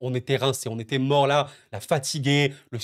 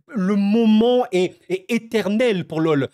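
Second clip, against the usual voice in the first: drawn out, louder and higher, voice swelling; overcome with emotion; 5 semitones higher, 5 dB louder, slower than usual. Real, not acted. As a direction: savour each word like a historic moment. Ému par la qualification lyonnaise. (lent, ému, plus fort et plus aigu)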